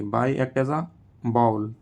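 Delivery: ordinary talk, nothing marked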